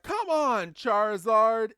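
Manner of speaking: loud, high plea to obey; exasperated calling out. Retold or imitated. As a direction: shout to a Pokemon that won't listen. Ash urging a Pokemon that ignores him.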